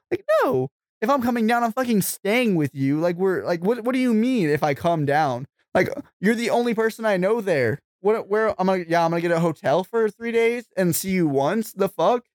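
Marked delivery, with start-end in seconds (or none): 0.1-8.5 real exasperation, high and heated; heated rant. Real, not acted. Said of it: "I'm heated right now". 8.9-12.2 heated sarcasm. Real, not acted. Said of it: "I'm heated right now".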